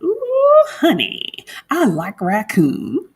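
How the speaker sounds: a light imitation of a country Southern accent, slow on the raccoon line; a hint of an Alabama country accent. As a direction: lightly mimic a country girl's squeal and drawl. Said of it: "really Southern accent".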